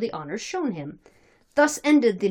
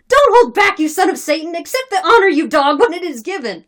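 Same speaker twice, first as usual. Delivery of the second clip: high, loud, forceful urging; shouted exhortation; 9 semitones higher, 10 dB louder than usual. Acted. Mob forcing the honor on him, shouted. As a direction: bellow at a reluctant man.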